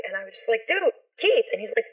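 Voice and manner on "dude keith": high-pitched mock holler; retold calling out. Sister calling brother inside about the helicopter, retold high holler. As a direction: call urgently to him, voice pitched up.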